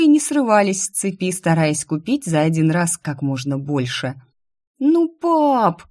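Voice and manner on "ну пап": whiny plea to dad; whining. A narrator's reading. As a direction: whine it like a child. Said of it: "заныла Инесса".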